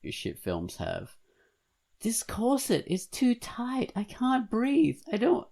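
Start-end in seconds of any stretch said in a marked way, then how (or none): 2.0-5.5 high, slow gasping damsel voice; breathless corset heroine. Retold or imitated. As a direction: squeeze it out like a laced-up heroine. Mocking corset scene cliche.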